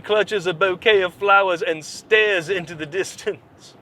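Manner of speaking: hammed-up gossip column read, slow; mock news read. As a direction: read it like a syrupy tabloid narrator. Reads article in mock voice.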